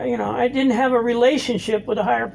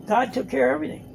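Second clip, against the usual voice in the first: usual speaking voice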